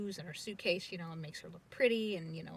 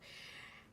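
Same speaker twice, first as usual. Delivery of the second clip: soft tender sigh; 16 dB quieter than usual. Real, not acted. Moved by grandfather's love.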